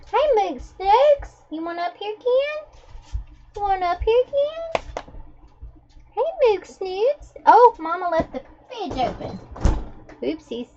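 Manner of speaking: high sing-song coo to the dog. Real, not acted. Owner calling her dog up onto the seat in a high baby-talk coo.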